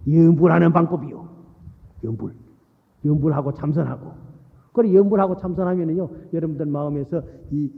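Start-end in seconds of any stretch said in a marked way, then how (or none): none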